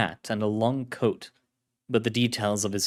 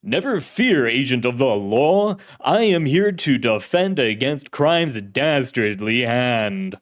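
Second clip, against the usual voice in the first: loud, swooping 1930s serial actor; old-time radio hero; 2 semitones higher, 6 dB louder than usual. Acted. Shadowy hero reassures an agent of the law, theatrical radio-hero voice. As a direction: declaim like a grand radio crime-fighter. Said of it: "voice was like an actor in an old-fashioned serial".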